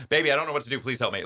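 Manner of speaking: playful helpless whine for rescue; helpless pleading. Retold or imitated. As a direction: beg your partner for help, hammed-up. Acts out pleading with partner.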